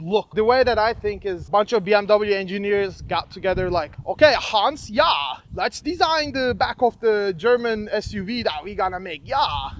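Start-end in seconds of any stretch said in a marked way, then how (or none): 4.1-9.7 light mock German engineer accent; a hint of a German engineer planning a design; playful German accent, barely put on. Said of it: "I apologize for my German accent".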